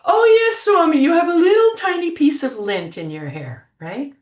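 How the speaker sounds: high, loud, overjoyed answer to the teacher; eager excited reply. Retold or imitated. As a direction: blurt it out like a thrilled devotee. Retelling her eager answer to Swami.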